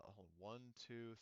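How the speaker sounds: ordinary talk, nothing marked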